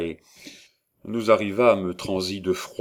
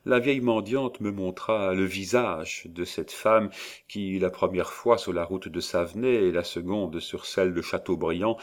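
ordinary delivery, nothing marked

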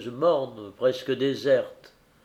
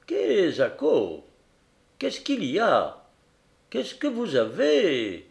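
capitaine surpris; 5 semitones higher, slower than usual. Acted. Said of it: "fit le capitaine Barbassou".

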